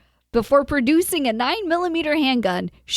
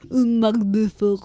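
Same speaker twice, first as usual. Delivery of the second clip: put-on French accent. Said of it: "say with a French accent".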